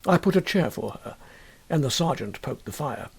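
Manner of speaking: ordinary delivery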